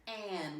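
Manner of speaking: "can" split as k, then a stretched "an"; "can" said as a separate k and "an"; sounded out. Shown as a demonstration. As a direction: break "can" into its sounds for a learner. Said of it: "What do you hear".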